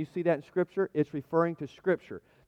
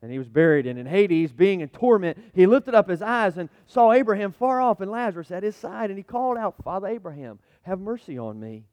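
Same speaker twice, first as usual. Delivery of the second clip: reads the passage with rising force; dramatic scripture reading; 3 semitones higher, 4 dB louder than usual. Acted. Pastor reading Luke to the congregation, dramatic. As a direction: give the rich man's cry weight. Preacher reading the rich man's plea.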